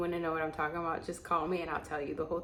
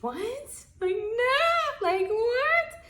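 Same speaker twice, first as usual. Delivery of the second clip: high squeaky mock disbelief; imitating her own shock; shocked squeak; 6 dB louder, much slower than usual. Retelling her own shocked reaction.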